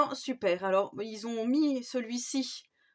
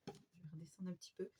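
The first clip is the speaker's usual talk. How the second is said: whisper; 4 semitones lower, 16 dB quieter, faster than usual. Real, not acted. Aside while moving the camera.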